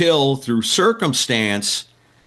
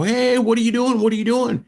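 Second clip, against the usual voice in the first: high, drawn-up "hey" of mock alarm; retold alarmed challenge; 11 semitones higher than usual. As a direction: call out to stop someone, pitch jumping up. Teller confronts a fan climbing over.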